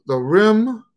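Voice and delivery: ordinary talk, nothing marked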